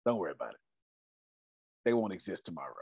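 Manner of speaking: soft, low mobster menace; mob tone. Retold or imitated. Imitating Ken promising to handle a problem, mob tone. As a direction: deliver the threat quietly, deep in the voice. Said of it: "that's that mob tone".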